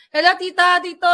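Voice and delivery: high, loud bubbly hello; chatty greeting. Retold or imitated. Greeting kababayan couple abroad, high bubbly cheer. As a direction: greet like a chatty old friend. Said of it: "machika boom boom talaga ako".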